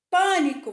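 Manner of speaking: "Pânico" with a loud, high "Pâ"; stressed first syllable. Shown as a demonstration. Teacher picking out the syllable to colour, stress on "Pâ". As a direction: punch the "Pâ" in "Pânico". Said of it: "você vai pintar o pá".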